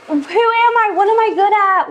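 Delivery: high, wailing questions; hammed-up lost cry; mock despair. Retold identity crisis.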